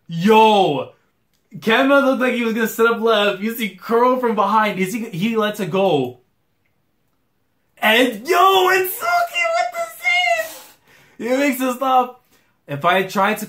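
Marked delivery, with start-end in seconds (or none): 0.1-6.2 loud, raised hype commentary; excited reaction. Real, not acted. Reacting to a surprise let-go, excited play-by-play. 7.8-12.2 very high, screaming play-by-play; excited shout. Real, not acted. Reacting to Suki's save, high excited shouting.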